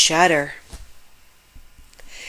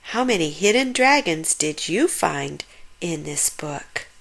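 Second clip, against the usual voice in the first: plain reading voice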